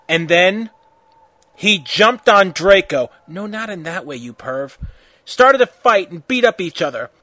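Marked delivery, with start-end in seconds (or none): none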